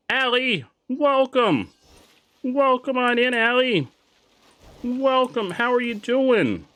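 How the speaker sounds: high, loud welcoming voice; cheery shopkeeper greeting; greeter voice. Acted. Greeting a customer in game.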